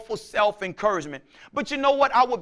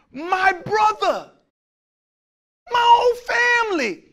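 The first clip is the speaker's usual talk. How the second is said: drawn-out, much higher shouted plea; anguished cry; 10 semitones higher, 3 dB louder, slower than usual. Acted. Preacher crying out a brother's betrayal, high and loud.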